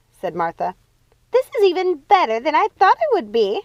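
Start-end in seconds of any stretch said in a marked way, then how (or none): 1.3-3.6 laughing. A narrator's reading. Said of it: "laughing so hard she fell".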